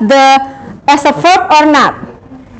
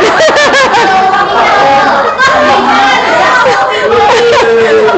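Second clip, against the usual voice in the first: long, high burst of laughing; 8 semitones higher than usual. Real, not acted. (tertawa lepas bernada tinggi)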